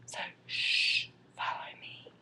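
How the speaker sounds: real whisper, very soft; hushed whisper. Real, not acted. Mother leading viewers to napping Ethan, hushed whisper. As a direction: whisper so the sleeper won't wake. Sneaking in on sleeping baby.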